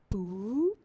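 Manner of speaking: short rising boop; soft electronic-style blip; message alert boop. Retold or imitated. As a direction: imitate a text notification sound.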